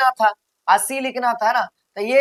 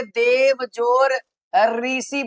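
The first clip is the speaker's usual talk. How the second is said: stretched demo; much slower than usual. Shown as a demonstration.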